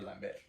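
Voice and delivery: Lambert as "lam-BAIR", silent T; no-T pronunciation. Shown as a demonstration. Insisting on the actor's name, Lambert without a T. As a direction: leave the T off Lambert, French-style. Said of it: "how to say Lambert".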